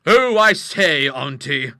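slow, drawn-out protest from the nephew; loud opening, lazily stretched; drawl. A narrator's reading. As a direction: drawl it at your aunt. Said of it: "drawled her nephew".

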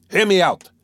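high, loud insistence; furious demand. A narrator's reading. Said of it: "he cried with redoubled fury".

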